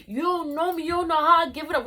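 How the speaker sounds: high, loud, imitated tough-guy rant; going off. Classmate really going off at professor, heated warning. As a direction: square up and warn them off. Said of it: "really going off".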